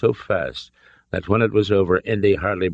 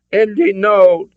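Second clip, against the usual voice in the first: very high, loud desperate cry; begging; 8 dB louder, slower than usual. A narrator's reading. Hostage cries out to Indy, desperate begging. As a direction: beg him at full pitch. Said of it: "Elsa begged".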